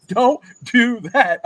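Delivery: slow, loud, drawn-out warning; slow scolding. Retold or imitated. Coach repeats the warning to his son, slow and loud. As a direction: stretch each word as a stern warning. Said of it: "I said".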